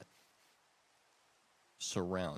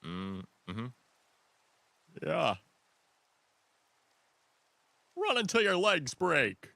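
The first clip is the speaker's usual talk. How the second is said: Joe-like voice; 7 semitones higher, 6 dB louder than usual. Pretend. Quoting the abridged anime to friends, loud cartoon cop bark. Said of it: "You sound like ... Family Guy. Joe".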